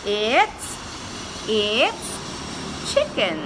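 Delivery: drawn-out slow chicken; slow modelling. Shown as a demonstration. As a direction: stretch it out for a child. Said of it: "modelling word slowly".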